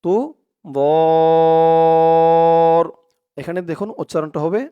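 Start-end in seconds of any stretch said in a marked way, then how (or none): 0.6-2.8 বর held long and loud on a steady tone; long madd. Shown as a demonstration. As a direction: stretch বর out for the full prolongation. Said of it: "আবার টান হবে". (বর লম্বা টেনে জোরে)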